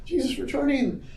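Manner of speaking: high-pitched, hammed-up alarm; mock worry. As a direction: wail in pretend dread. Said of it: "there's all these worries".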